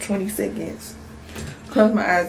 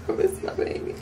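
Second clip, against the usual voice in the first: whimpering whisper; 6 dB quieter, faster than usual. Retold or imitated. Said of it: "I cried 'cause I'm like".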